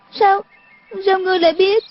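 put-on high, startled girl's voice; shaken astonishment. A narrator's reading. Girl learns he knows her weapons, astonished. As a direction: stammer the question in high-pitched alarm. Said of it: "kinh ngạc run run hỏi".

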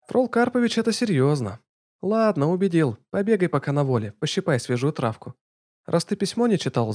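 high businesslike woman; brisk young woman. Acted. Lana briefing.

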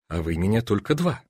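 ordinary delivery

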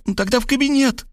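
put-on very high, sing-song girl voice; perky Varvara. A narrator's reading. Varvara chirping to Rob she'll hide in the study, high. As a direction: toss it off high.

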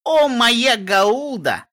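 put-on high, slow teen squeal; drawn-out shout of "О, моя Гауда"; loud exclamation. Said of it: "внезапно громко воскликнула Реджи".

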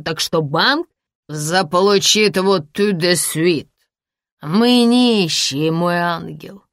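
put-on drunk man sneering at his wife; bitter drunk man. Drunk Sasha speech.

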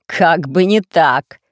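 mocking villain. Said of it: "засмеялся повелитель".

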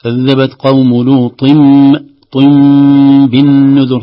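slow and loud, لوط طن بالنذر with a long nasal m; iqlab demo. Shown as a demonstration. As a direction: stretch لوط طن into a humming م before بالنذر. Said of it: "فتنطق ميم". (بطء ووضوح مع غنة الميم في لوط طن بالنذر)

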